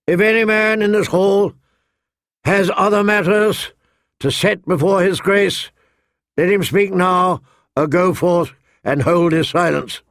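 high, projected herald's proclamation; booming herald. A narrator's reading. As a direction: boom it out to the whole hall. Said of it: "The herald's voice boomed out".

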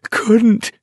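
high, broken sobbing cry. A narrator's reading. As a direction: sob the word out in despair. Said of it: "he was sobbing".

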